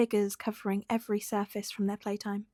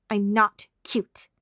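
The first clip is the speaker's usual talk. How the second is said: pouting protest; 8 dB louder, much slower than usual. Acted. Small Bakugo protests.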